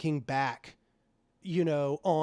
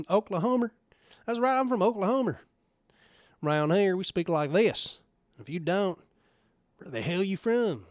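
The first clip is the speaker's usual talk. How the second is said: put-on Oklahoma accent; 3 semitones higher than usual. Streamer playing an Oklahoman for chat, Oklahoma twang. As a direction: ham up a rural Oklahoma accent like a proud local. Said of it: "that is not my actual accent".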